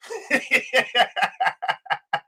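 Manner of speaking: soft laugh at himself; relieved laugh after the fervor. Real, not acted. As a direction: chuckle quietly. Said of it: "laugh after invocation".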